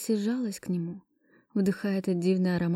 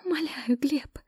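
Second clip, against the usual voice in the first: numb-lipped whisper; 7 semitones higher than usual. Acted. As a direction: breathe the plea out. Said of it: "шептала я онемевшими губами".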